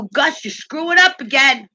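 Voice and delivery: exasperated customer complaint, voice pushed up; retold telling-off. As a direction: lay into the manager.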